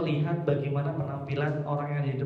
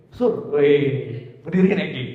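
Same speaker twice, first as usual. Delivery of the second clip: bro-style call; 2 semitones lower, 4 dB louder, slower than usual. Shown as a demonstration. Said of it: "Kalau ke Surya kayaknya lebih ke bro".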